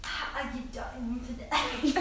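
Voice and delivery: slow, shaky attempt at a French accent; joke-sounding French accent. Shown as a demonstration. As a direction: try a bad French accent, slowly. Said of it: "I try to do a French accent".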